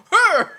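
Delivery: high, loud effort cry; diving grunt-yell. Acted. Game master as the player diving from gunfire, loud effort yell. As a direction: cry out as you throw yourself aside. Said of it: "You dive and you go".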